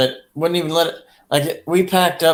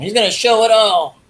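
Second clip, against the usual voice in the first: mock hype; 8 semitones higher, 4 dB louder than usual. Joking about stripper.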